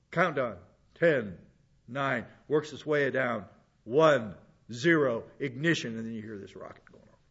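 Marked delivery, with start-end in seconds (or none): 0.1-2.2 slow, lower mission-control countdown; official NASA voice. Retold or imitated. Pastor imitating the countdown in a song, lower official announcer voice. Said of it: "in that kind of official nasa voice". 3.8-6.0 lightly imitated launch announcer, lower pitched; a hint of mission control counting to liftoff; official NASA voice. Pastor imitating the song's rocket launch, lower official countdown voice. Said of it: "in that kind of official nasa voice".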